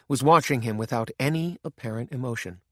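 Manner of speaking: ordinary delivery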